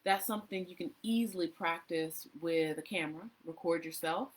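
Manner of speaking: usual speaking voice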